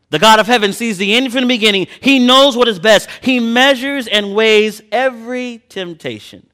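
loud, high, ringing pulpit delivery; preaching at full force. Acted. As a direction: preach it to the congregation. Preaching climax.

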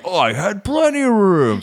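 high, loud huffy protest; mock the driver losing his cool; defensive driver. The Mercedes driver protests indignantly, high and loud.

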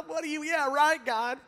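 high-pitched scornful laugh into words; acted-out derisive scoff; mocking laughter. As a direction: laugh it off in disbelief.

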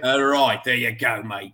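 lightly put-on English accent; a touch of mock English. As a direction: play a casual Englishman, lightly. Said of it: "I did an Englishman".

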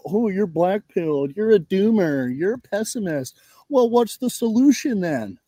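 sneering quote of critics; mock the people who call you a doomer. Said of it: "do what they normally do and go".

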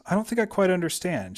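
a hint of a confused granddaughter; lightly voiced Pauline, unsure; lightly voiced young woman. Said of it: "she said".

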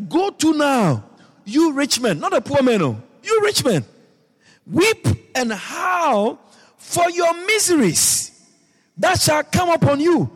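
high, loud, slow preaching cry; fiery scripture reading. Acted. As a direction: proclaim it like a prophet calling down judgement. Preacher reads scripture at full force.

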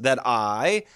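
"that I" with weight on "I"; light "that", strong "I"; iambic stress. Shown as a demonstration. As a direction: lean on the second word of "that I". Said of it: "unstressed, stressed".

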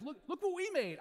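high, bright proud call; proud excitement. Retold or imitated. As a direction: show off a newborn proudly. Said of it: "I'm like".

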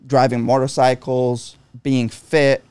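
ordinary delivery

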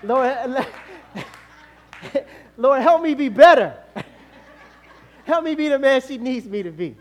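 loud laughing prayer; laughing plea. Real, not acted. Husband laughing while pleading to God. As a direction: beg through laughter.

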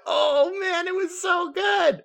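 high, slow, thrilled gush over the shot; gushing excitement. Retold or imitated. A fan gushing to a friend about a great shot, in a high slow thrilled voice. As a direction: swoon over how good it was, way up high. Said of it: "I'm like".

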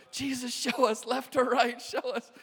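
high, loud plea imitating two girls; girls praying for directions. Two girls in the back asking Jesus the way, high mock pleading. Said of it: "the two girls were in the back like".

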